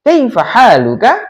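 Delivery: "Kaifa haluka" with the pitch climbing on the end; questioning rise. Shown as a demonstration. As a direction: raise the tone on the last syllable of "Kaifa haluka". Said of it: "Nada naiknya". (nada naik di ujung "Kaifa haluka")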